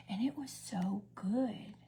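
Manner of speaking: slow breathy unvoiced whisper; savoring whisper. Real, not acted. Praising the chicken dish, slow savoring whisper. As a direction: whisper it slowly like tasting it. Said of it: "savoring the food".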